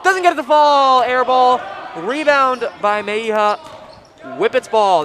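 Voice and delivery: high, loud rapid-fire game call; excited play-by-play. Real, not acted. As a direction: call the fast break live. Live play-by-play continues.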